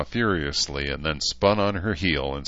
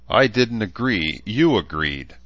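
heated, a bit louder retort; answering hotly; 5 semitones higher, 5 dB louder than usual. A narrator's reading. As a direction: snap back at your mother. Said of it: "Rachel answered hotly".

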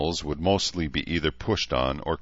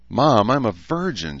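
higher, a mock-level shout of protest; 6 semitones higher, 4 dB louder than usual. A narrator's reading. Daughter protesting to her mother, out of temper shout. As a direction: blurt it out, out of temper. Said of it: "she shouted".

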